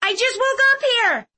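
put-on high, snappish girl's retort; snapped. A narrator's reading. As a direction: snap back in a high voice. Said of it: "Clove snapped".

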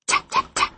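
whispered tapping noise; tapping sound. A narrator's reading. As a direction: tap out the knock with your breath. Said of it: "tap, I went".

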